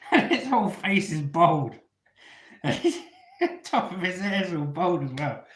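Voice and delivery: can't get through it for laughing; real laughter over the punchline. Real, not acted. Cracking up at the punchline.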